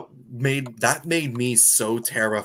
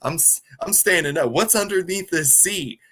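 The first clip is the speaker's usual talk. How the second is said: rising, louder suspicious demand; getting louder and higher as he catches on; retelling Tyler catching on to the shock seat, suspicious; 6 semitones higher, 3 dB louder than usual. Retold or imitated. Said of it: "He's like".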